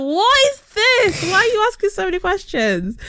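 high, exasperated mock outburst; exasperated high-pitched protest; irritated. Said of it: "you could tell I was getting irritated".

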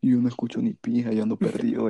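usual speaking voice

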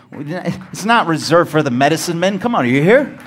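preaching loud and high; preacher's shout to the crowd; rising shout. Real, not acted. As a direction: lift it to a ringing call.

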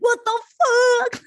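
high, slow startled shout; shocked yell. Retold or imitated. Acting out sudden shock.

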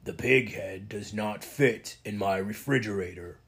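slow rumbling half-troll voice. A narrator's reading. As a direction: rumble it out slowly like a big troll. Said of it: "X rumbled".

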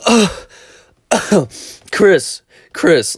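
imitating the boss's loud call. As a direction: call Chris like you can't reach him. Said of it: "like that".

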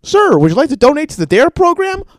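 high, loud chirpy pitch; pushy canvasser. Retold or imitated. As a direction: mimic the clipboard guy's sales chirp. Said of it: "some fucking jerk-off with a clipboard and iPad goes".

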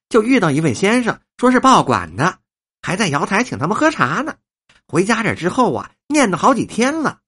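high, effusive chatter to honoured guests; gushing old woman, delighted. Acted. Old woman learns guest is a newspaperman, gushing and humble. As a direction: flatter the guests in a high, excited voice.

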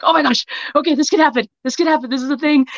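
high-pitched, giddy self-talk; eager, lifted inner voice; excited realization. Retold or imitated. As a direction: relive the thrill of a sudden possibility. Reliving realizing she could write too.

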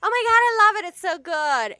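loud, high mock gushing; gushing delight. Customer loving the drink the bartender made, hammed-up gushing. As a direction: squeal like a thrilled customer.

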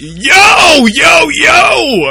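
loud hyped yo call; shouting. Real, not acted. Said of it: "I feel like I gotta shout too".

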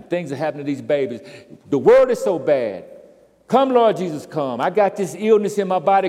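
building from firm to a full loud cry; complaining prayer. Acted. Preacher voicing a selfish believer's prayer, rising to a loud cry. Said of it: "the paradigm we say that in is".

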